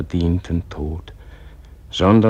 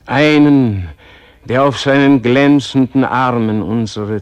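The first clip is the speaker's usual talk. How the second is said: rising, louder, higher impassioned pleading; fervent plea; 4 semitones higher, 6 dB louder than usual. Acted. Praying man implores God for a new messenger, fervent and loud. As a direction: beg heaven with mounting fervour.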